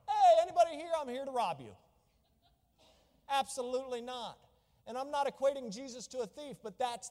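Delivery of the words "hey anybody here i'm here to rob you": a much higher, much louder silly burglar calling out; mock loud burglar. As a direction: ham up a clumsy thief announcing himself. Mock burglar announcing himself loudly.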